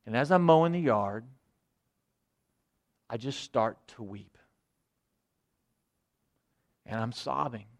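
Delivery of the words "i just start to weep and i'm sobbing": soft and heavy, holding back tears; choked-up sadness. Real, not acted. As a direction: let the grief pull the voice down to almost nothing. Recalling weeping at son's departure.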